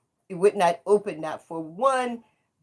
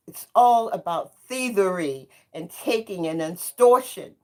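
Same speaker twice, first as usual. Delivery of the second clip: usual speaking voice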